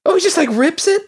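high shocked disbelief. Real, not acted. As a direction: squeal it in horrified surprise. Shocked at rabbit skinning.

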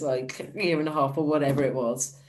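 ordinary talk, nothing marked

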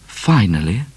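usual speaking voice

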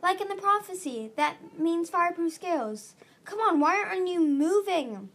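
high, loud, exasperated appeal; frantic urging. A narrator's reading. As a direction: urge them on desperately. Frantic urging to save Viper.

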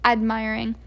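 ordinary delivery

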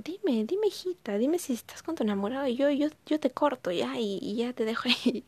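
madre que ruega, mucho más aguda; mamá suplicante. Retold or imitated. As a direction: imita a la mamá pidiendo con zalamería. Mamá suplicando, voz imitada. (mamá que suplica a su hija, voz más aguda y rápida)

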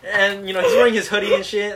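ordinary talk, nothing marked

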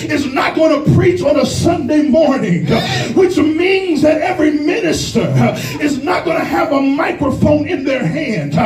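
fired-up preacher working the congregation; full-force preaching. Real, not acted. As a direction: preach it hard in gasping, punched-out phrases.

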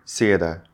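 theater with s for th. Shown as a demonstration. Teacher shows the s error in theater. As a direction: hiss an s in place of th in theater. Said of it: "I replace it with an S".

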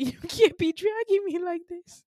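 high, loud, laughing complaint; mock protest. To Jessica dragging them along, mock protest.

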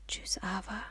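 very soft farewell; whispered goodbye. A narrator's reading. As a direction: murmur goodbye to someone out of earshot. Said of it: "flüsterte ich". (leise geflüsterter Abschied)

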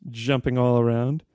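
ordinary talk

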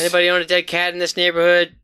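fast loud calling out; hollering in a forest. Acted. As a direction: call out to the neighborhood. Calling out down the street.

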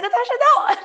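high-pitched retold wail of protest; high, playful yelp of dismay; mock dismayed cry. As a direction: cry out in mock horror at a friend. Retells yelling at friend hanging up.

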